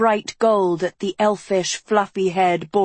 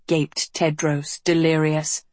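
ordinary delivery, nothing marked

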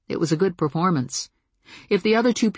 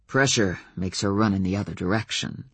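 dry; 6 semitones lower than usual. A narrator's reading. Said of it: "Adam said dryly".

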